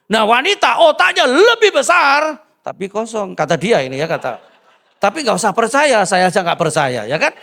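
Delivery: full-voiced preacher punchline; preaching at full force. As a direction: drive the joke home to the crowd. Preaching loudly to the congregation.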